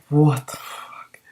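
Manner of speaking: whispered aside. Real, not acted.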